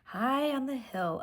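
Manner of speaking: plain reading voice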